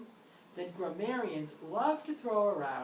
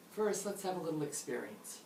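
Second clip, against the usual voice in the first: usual speaking voice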